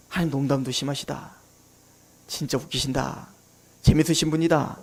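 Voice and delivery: low, hushed scoff under the breath; scoffing aside. Retold or imitated. Sarah privately scoffing at God's promise, low and mocking. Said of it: "비웃더라".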